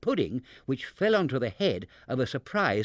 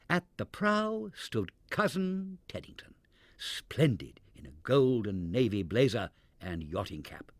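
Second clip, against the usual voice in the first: ordinary delivery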